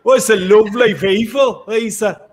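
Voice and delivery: high, loud mock-Scottish; terrible Scottish accent. Presenter teasing a co-host who mocked it, terrible Scottish accent. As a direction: double down on the bad Scottish accent. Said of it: "that's a terrible accent".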